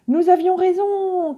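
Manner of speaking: high, loud, out of breath from the dive; breathless cry. A narrator's reading. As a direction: call out the news, panting. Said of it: "cria-t-il en soufflant". (crié à bout de souffle)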